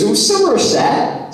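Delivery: high, slow mock Somerset accent on "Somerset". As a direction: imitate Somerset being said back in a put-on West Country accent. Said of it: "always in that accent".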